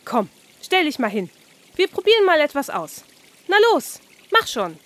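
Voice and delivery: high, quick, pushy little fish; the carp urging Oskar to stand up, high and eager. Acted. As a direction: chivvy him along like a bossy carp. Fish character pushing Oskar.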